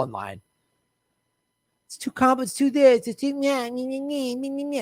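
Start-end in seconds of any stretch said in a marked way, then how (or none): 1.9-4.8 mock nitpicking, trailing into meh-meh noises; mocking whine. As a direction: mimic their feeble complaining in a high little voice. Mocking mealy-mouthed liberal objections.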